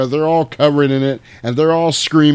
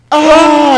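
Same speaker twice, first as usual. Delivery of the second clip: short high yelp of fright; a loud startled shriek; hammed-up gasp of horror; mock scream; 9 dB louder than usual. Sam screams seeing vampires.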